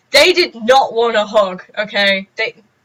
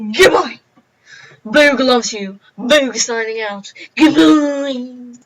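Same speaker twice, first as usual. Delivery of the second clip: silly sign-off voice; 2 semitones higher, 4 dB louder, slower than usual. Acted. Vlog sign-off, odd voice.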